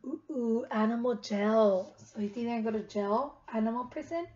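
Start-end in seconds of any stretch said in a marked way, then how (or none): none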